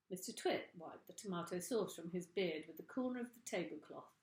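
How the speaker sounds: plain reading voice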